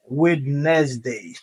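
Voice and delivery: Wednesday read as written, "wed-nez-day"; funny literal pronunciation. Shown as a demonstration. Teacher reading the board wrongly on purpose, literal Wednesday. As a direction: sound the D and middle syllable in Wednesday. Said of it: "si nous t'appelons comme ça, that sounds funny".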